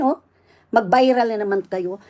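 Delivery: normal talking voice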